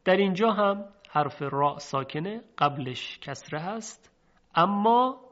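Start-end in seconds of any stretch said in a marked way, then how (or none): none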